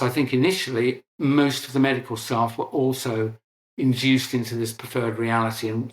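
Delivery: ordinary delivery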